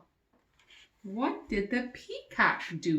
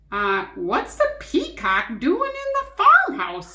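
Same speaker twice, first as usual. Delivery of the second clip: a hint of a puzzled old farmer; lightly voiced farmer, louder and higher; 8 semitones higher, 8 dB louder than usual. A narrator's reading. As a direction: farmer puzzled by a peacock in the farmhouse. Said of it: "said Farmer Tucker".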